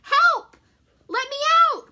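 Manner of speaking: high, loud cry of Stuart trapped; crying for help. Acted. Trapped Stuart calls out for help, high and loud. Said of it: "cried Stuart".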